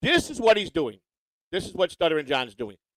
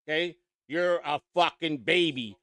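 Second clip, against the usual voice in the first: ordinary talk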